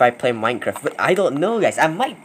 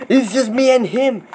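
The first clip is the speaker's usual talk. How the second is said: usual speaking voice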